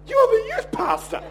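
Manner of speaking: high-pitched mock disbelief; retold astonished protest; incredulous. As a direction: squeak it out, appalled. Acts out shocked reply to confession.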